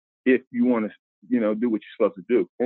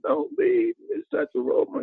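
much higher pitched imitation of a tearful old lady; crying old lady. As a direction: mimic a little old lady pleading through tears, pitched way up. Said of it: "little old lady came up to me crying".

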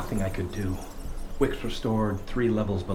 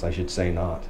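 usual speaking voice

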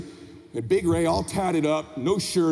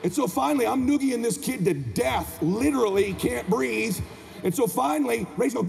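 ordinary talk, nothing marked

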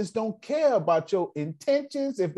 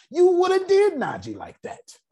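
higher and louder preaching punch; authoritative; 11 semitones higher, 5 dB louder than usual. Acted. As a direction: drive the truth home hard. Preaching climax.

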